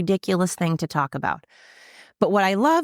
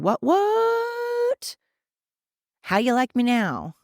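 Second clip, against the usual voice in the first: high mocking taunt, rising to a squeal; taunting karma; 6 semitones higher, slower than usual. Karma gloating back at the name-callers, a high mocking squeal. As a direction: gloat like karma finally getting even. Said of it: "karma is turning around and saying".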